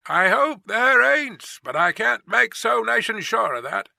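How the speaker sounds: doubtful guard grumbling aloud; gruff soliloquy. A narrator's reading. Guard to himself, doubting the messenger, gruff grumble. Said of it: "in a gruff soliloquy".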